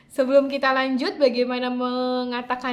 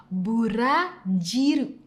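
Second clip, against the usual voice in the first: Burajiru drawn out slowly, syllable by syllable; slow model; 3 semitones lower, slower than usual. Shown as a demonstration. Teacher repeating Burajiru slowly for students to copy. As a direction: let learners hear every sound of Burajiru.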